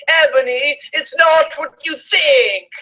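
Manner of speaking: high, pleading cry, hammed up; mock sad scream. A narrator's reading. Draco begging his girlfriend, hammed-up high wail. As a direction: beg her, voice cracking high. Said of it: "Draco screamed sadly".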